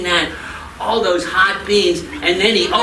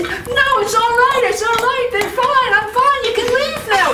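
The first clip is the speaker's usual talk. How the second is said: squeaky, much higher, strained through pain; voice getting higher; 3 dB louder than usual. Acted. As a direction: force it out high and pinched, pretending all is fine. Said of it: "His voice was getting higher and higher".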